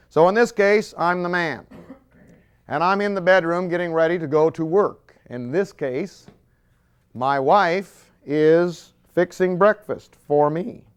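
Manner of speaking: ordinary talk, nothing marked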